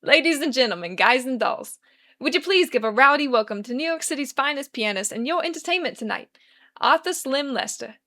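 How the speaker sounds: a loud New York emcee announcement; sharp New York accent. Acted. Charlie announcing Lester to the crowd in a New York accent. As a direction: sell it like a New York showman. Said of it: "in his sharpest New York accent".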